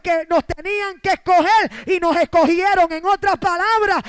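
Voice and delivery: loud, ringing preacher's cry; loud Pentecostal preaching; full-force preaching. Acted. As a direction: preach it out to the whole church.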